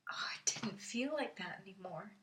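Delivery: soft, whispery realization; quiet, intimate confession; hushed relief. Acted. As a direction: let it out very softly, nearly whispered. Tender turn on meeting Macy.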